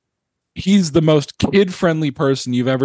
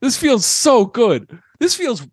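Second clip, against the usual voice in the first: excited; 7 semitones higher than usual. Real, not acted. Celebrating the team's playoff win.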